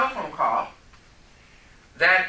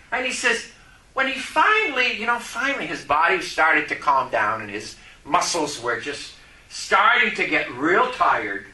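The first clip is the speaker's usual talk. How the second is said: normal talking voice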